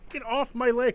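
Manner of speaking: high, loud yelps of pain; panicked son. Acted. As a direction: yell at the thing burning your leg. Son fights off the slime.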